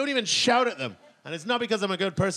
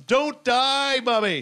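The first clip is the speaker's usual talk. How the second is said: put-on pleading child cry; 5 semitones higher, 4 dB louder, slower than usual. Toddler calling as mum leaves.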